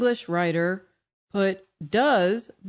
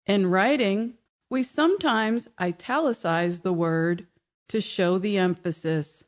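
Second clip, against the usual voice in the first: ordinary delivery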